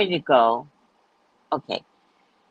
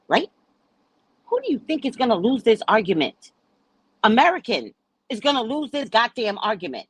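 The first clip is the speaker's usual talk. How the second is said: ordinary talk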